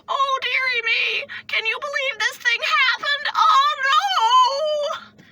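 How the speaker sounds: very high, loud, slow dismay; exaggerated high-pitched lamenting impression; mock mother-in-law. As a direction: wail it like a caricature fussy mother-in-law. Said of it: "when I imitate my mother-in-law".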